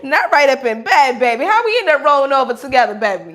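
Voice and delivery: mock sultry pillow talk to a lover, a laugh breaking through; playful seductive act. Teasing a partner in bed, mock sultry voice. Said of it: "let me stop playing".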